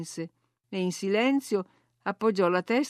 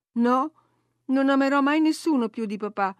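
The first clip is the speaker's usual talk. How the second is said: firm, higher-pitched earnest reply; serious; 6 semitones higher, 4 dB louder than usual. A narrator's reading. Girl answering her cousin about loving papà, serious. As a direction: state it earnestly, pitch lifted. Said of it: "rispose lei seriamente".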